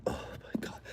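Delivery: breathless unvoiced "oh my God"; whispered aside. Real, not acted. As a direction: say it under your breath, shocked.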